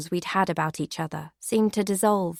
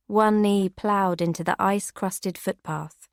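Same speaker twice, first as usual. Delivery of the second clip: plain reading voice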